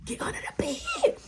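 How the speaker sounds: strained, squeaky whispered order; retold panicked hush; urgent whisper. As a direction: whisper frantically to a friend to hide. Hiding friend from mom.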